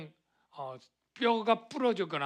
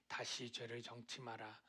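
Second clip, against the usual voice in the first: very soft, lowered, tender voice; warm, gentle; 6 semitones lower, 15 dB quieter than usual. Acted. Jesus to the woman caught in sin, warm and gentle. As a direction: speak it quietly as gentle forgiveness. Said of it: "따뜻하게 얘기하면서".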